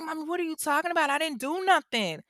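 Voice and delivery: put-on high, rushed child's denial; scared young boy. Boy answering his screaming mother, in a scared child voice. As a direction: imitate a frightened kid pleading innocence. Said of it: "Steven is shaking".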